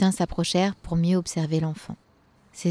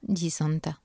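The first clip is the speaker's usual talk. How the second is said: usual speaking voice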